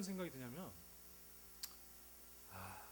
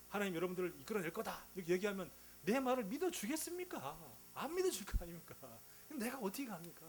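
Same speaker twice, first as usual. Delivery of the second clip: higher, pleading objections as reluctant Moses; acted fearful protest; 4 semitones higher, 3 dB louder than usual. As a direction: protest to God with rising exasperation, drawing out questions. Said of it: "얼마나 마음이 두려웠겠어요".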